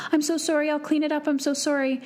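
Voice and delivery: put-on high-pitched little-boy apology; imitating anxious child. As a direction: mimic a child anxious to make amends.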